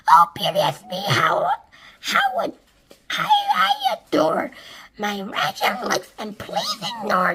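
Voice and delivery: high, preening sing-song boast; vain aunt Spiker. A narrator's reading. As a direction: show off, simpering and vain. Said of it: "And Spiker said".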